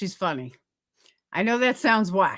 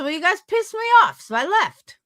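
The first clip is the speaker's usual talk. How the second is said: higher, louder, fast huffy comeback; indignant retort; 9 semitones higher, 3 dB louder than usual. Retold or imitated. Retelling her Discord walkout, hammed-up fast huffy retort. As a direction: fire it off quickly like a half-mock tantrum. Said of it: "I said".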